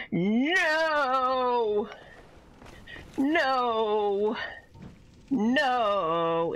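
long drawn-out cries of dismay; real dismay. Real, not acted. Player seeing trouble ahead in the game, dismayed. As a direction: wail it, stretching the vowel out.

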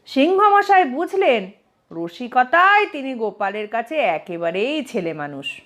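plain reading voice

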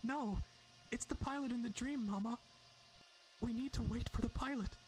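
put-on high little girl voice, pushing back. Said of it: "the daughter says louder".

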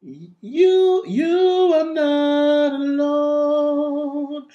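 fuller, natural singing tone; neutral larynx. Shown as a demonstration. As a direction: sing in your own voice. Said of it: "I can sing in my neutral level".

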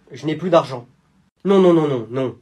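ordinary talk, nothing marked

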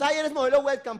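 high, squealing fan voice; a fan squeals it from the crowd; excited fan squealing her praise. Retold or imitated. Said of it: "por ahí alguien gritando".

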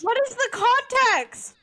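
high, loud, drawn-out laughing disbelief; laughing outcry. Real, not acted. Co-host demanding context for the absurd question, laughing loudly. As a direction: shriek it through real laughter.

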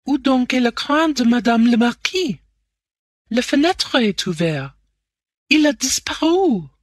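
high-pitched, frantic French; shrieking French maid. A narrator's reading. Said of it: "there was a little shriek".

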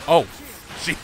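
ordinary talk

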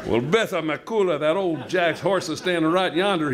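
Texas drawl. Shown as a demonstration. Reader redoes the line in Texan accent. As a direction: lay on the Texas cowboy twang. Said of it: "Let me do that in the right accent".

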